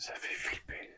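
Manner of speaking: hushed, scared whisper. Real, not acted. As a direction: whisper it under your breath. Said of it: "Pourquoi je parle tout bas".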